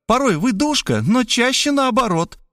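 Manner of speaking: high, teasing woman's voice; woman scolding Robert in the trench; mocking reproach. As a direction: tease him with scorn. She mocks him.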